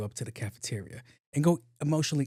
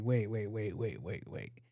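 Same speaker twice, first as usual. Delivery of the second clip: wait repeated, fading much softer and lower; suspicious wait that dies away; wary tone; 3 semitones lower, 7 dB quieter, slower than usual. Retold or imitated. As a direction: back away slowly with each wait. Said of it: "she caught my tone".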